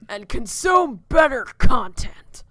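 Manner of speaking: loud, slow shout after them; parting shout. Acted. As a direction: call it out as they run. Calling after fleeing teens.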